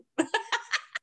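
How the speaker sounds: high giggling; laugh. Real, not acted. Laughs at bedroom joke.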